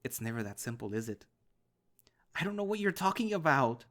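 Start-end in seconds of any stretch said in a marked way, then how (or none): 2.3-3.8 high, loud, strained and hoarse; shrill hoarse denial, voice cracking from screaming. Acted. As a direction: push the voice thin and raw. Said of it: "My voice is shrill and hoarse".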